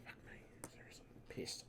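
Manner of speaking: exasperated whisper to no one; whispered curse. Real, not acted. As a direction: hiss it to yourself in frustration. Muttered curse after losing thread.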